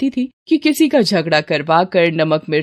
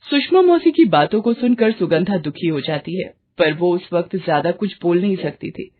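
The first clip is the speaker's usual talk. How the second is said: plain reading voice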